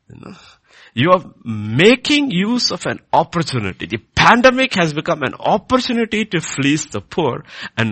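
loud, high indignant rebuke; fiery preaching. Acted. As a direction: thunder the accusation at the congregation. Preaches at full force.

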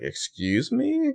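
high, slow, outraged excuse me; offended surprise. Retold or imitated. Boss stunned that her assistant talks back, offended. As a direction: stretch it out in shocked offense. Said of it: "no one's ever talked to her like this".

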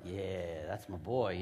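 low, cool mock approval; lightly acted low-key admiration; proud approval. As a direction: play a proud buddy, pitched well down. Disciples proudly approve of Jesus.

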